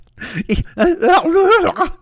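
high, loud choked squawking; bad dolphin imitation. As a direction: squeal like someone stabbed in the throat. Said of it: "stabbed Bobcat Goldthwait in the throat".

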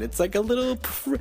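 high sing-song cutesy tone. Shown as a demonstration. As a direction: say it high and sweet. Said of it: "did I really just refer".

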